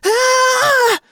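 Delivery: high, loud terrified shriek; panicked scream. Acted. Boy feels rat on his leg.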